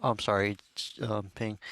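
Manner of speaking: ordinary delivery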